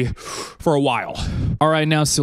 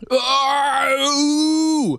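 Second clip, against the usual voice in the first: high "ah" rising into "ooh"; drawn-out high hoot of pain; pained howl; 9 semitones higher than usual. Real, not acted. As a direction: howl as the burn flares up. Burn hits again after question.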